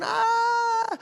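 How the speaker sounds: sudden high shriek of fright; terrified scream. Acted. Fishermen screaming at a ghost in the storm, high terrified shriek. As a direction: scream like you've seen a ghost. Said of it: "y gritaron".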